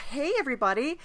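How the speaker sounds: normal talking voice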